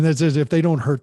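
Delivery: ordinary talk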